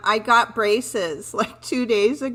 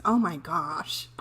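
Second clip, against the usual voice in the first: mock teen eye-roll; 5 semitones lower, 5 dB quieter than usual. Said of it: "and they just".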